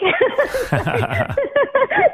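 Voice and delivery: long, high-pitched laugh; real laughter. Real, not acted. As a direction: burst out laughing at your own story. Laughs at bringing Mike along.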